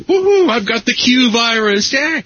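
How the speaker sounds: imitating an overjoyed fan, high-pitched; happy enthusiastic cheer. Fan boasting after drinking the star's cold water, enthusiastic cheer. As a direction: whoop it like a thrilled convention fan. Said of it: "very happily and enthusiastically gulps it down".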